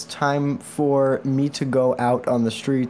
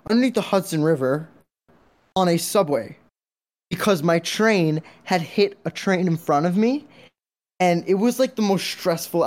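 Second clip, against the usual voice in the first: stressed retelling; 6 semitones higher than usual. Real, not acted. Stressed subway story.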